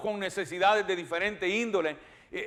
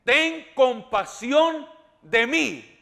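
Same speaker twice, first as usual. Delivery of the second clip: slow, drawn-out "Ten compasión de mí", each word leaned on; slow plea; much slower than usual. Acted. A blind beggar begging Jesus for mercy, slow and drawn out. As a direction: beg for mercy slowly. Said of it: "mire la manera que dice".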